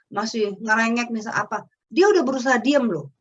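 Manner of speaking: ordinary talk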